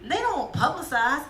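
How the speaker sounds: ordinary talk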